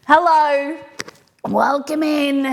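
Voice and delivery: the skull welcomes you in. Acted. Greets viewers as the skull.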